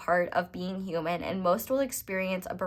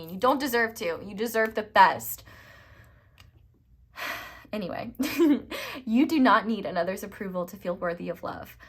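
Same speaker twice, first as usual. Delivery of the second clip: ordinary talk, nothing marked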